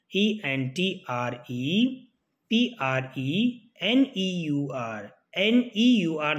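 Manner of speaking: each letter spelled very slowly and deliberately; slow spelling out. Shown as a demonstration. Teacher dictating the spelling to students, very slow letter by letter. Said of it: "spelling आपसे बार बार पूछते हैं".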